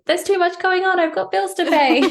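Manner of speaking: hammed-up high fretful voice of the worried human side; fretting human self. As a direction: whine about bills in a high put-on voice. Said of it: "And the human going".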